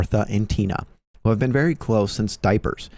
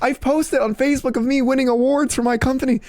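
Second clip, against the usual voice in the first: pitched way up, exasperated disbelief; exasperated high outburst. Retold or imitated. Telling his paintball teammate he's been sitting on that, high exasperated squeal.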